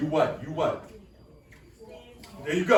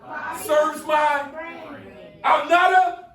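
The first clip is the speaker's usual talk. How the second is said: with attitude; 7 semitones higher, 5 dB louder, slower than usual. Shown as a demonstration. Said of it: "Come on, say it with an attitude".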